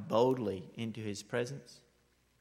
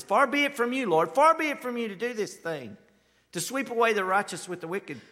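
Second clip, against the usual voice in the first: pleading; 8 semitones higher, 8 dB louder than usual. Acted. Abraham pleading with the Lord.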